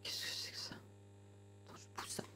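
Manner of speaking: tense hushed whisper; nervous whisper in the dark. Real, not acted. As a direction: breathe the words, afraid to be heard. Sneaking in a scary store.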